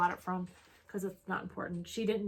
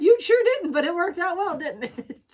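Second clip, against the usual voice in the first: excited; 9 semitones higher, 10 dB louder than usual. Real, not acted. Teasing delight at the gift.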